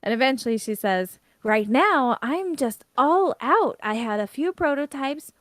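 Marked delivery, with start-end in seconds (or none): 1.4-5.3 high, emphatic exasperation; huffy complaint. Acted. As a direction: complain with raised pitch and stretched stresses. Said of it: "starts getting really huffy and complains".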